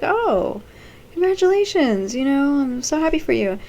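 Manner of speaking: high, sing-song forced cheer; mock polite congratulations. Nate politely congratulates Lisa, high and forced. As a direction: congratulate her too brightly. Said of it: "And he's like".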